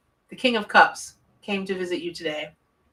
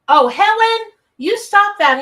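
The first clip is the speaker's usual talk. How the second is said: high, loud teasing scold; mock scolding; 12 semitones higher, 10 dB louder than usual. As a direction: scold a friend in fun, pitched way up. Playful scolding of a donor.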